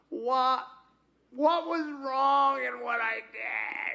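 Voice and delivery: mock outraged cry to God; mock indignant shout. Shown as a demonstration. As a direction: demand it like a wronged child. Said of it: "I don't wanna say this".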